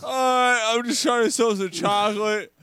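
high, loud mock child voice; little fat kid. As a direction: plead like a hammed-up little boy. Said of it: "and the little fat kid".

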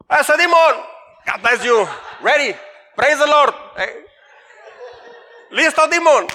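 loud and high, "demon" for Damon; cheerful mispronounced shout. Retold or imitated. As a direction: shout it gladly, butchering "demon". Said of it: "batalla a veces con las pronunciaciones". (grito alegre diciendo "demon" en vez de Damon)

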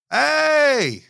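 high, hammy bad Irish accent; worst Irish accent. Imitating the Irish guy speaking from his grave, in a bad Irish accent. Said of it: "I'm doing the worst Irish accent".

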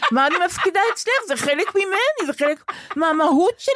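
high, outraged rhetorical questions; indignant protest. Real, not acted. Woman scoffs at her therapist's phrase, indignant and high. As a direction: push back up high, offended.

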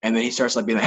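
usual speaking voice

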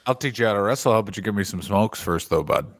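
low, lightly voiced Canadian hoser; small-town hoser bargaining for smokes.

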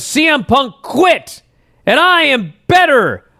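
high and slow, leaning on quit and better; defiant promo quote. Retold or imitated. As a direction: deliver it like a wrestler's defiant boast. Retelling MJF's defiant promo line.

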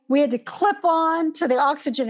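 ordinary talk, nothing marked

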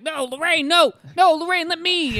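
high, loud panicked yelling; desperate shout. Retold or imitated. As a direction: scream for her to stop. Husband voiced crying out.

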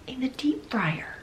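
breathless shocked whisper. Real, not acted. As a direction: lean in and whisper it. Gruesome detail dropped low.

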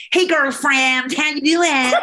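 hammed-up American girlfriend greeting; American accent. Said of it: "trying to do the American accent".

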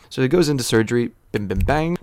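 plain reading voice

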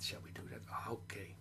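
the puppet's secret whisper. Acted. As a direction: breathe it like a secret in the ear. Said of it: "he's whispering something in my ear".

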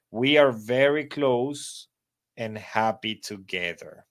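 slow, careful English read-aloud; slow model reading. Shown as a demonstration. Teacher reading the model paragraph for pronunciation practice, slow. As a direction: read it clearly for learners to copy. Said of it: "lo van a leer para que practiquen la pronunciación".

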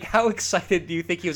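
ordinary talk, nothing marked

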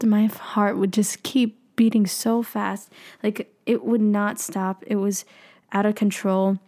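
ordinary talk

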